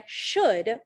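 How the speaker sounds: should hit harder and drawn out; stress on should. Shown as a demonstration. Parent quoting mask guidance to the school board, stress on should. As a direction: lean firmly on the word should. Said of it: "I stress the word should".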